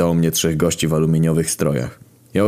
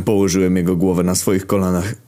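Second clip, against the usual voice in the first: plain reading voice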